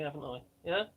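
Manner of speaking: ordinary talk, nothing marked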